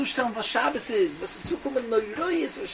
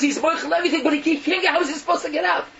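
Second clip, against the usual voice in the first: high, loud, urgent pleading; anguished plea; 8 semitones higher, 5 dB louder than usual. Acted. Messenger bird pleads the prisoner's case to his sister, anguished. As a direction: press the brother's plight, pitched way up.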